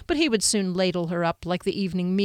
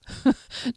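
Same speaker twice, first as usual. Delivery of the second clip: light little laugh; short amused chuckle; 5 semitones higher than usual. Acted. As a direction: chuckle briefly before answering. Kier amused by the offered knife.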